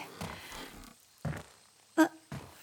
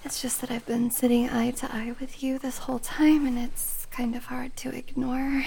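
shy whisper; 3 semitones lower than usual. Acted. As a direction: confess it in a hushed whisper. Whispered confession to listener.

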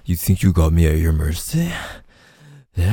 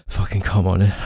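usual speaking voice

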